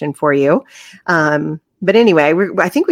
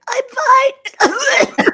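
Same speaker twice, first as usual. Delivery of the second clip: high, strangled imitation of choking; choked voice. Choking on water in a Zoom interview, strained imitation. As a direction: squeeze it out as if choking. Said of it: "I choked".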